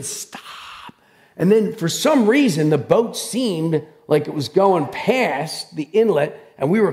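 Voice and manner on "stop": whispered stand-in for a scream; hushed mock scream. Preacher retelling screaming at the boat, voiceless mock scream. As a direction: mime a scream under your breath, without voice. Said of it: "I'm just screaming".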